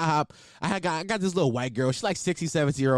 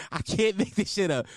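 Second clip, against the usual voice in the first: ordinary talk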